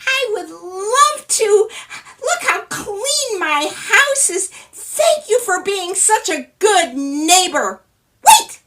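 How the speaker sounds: tiny man touched and grateful; delighted little man. Acted. Little man thanking his neighbor, slow high tiny voice.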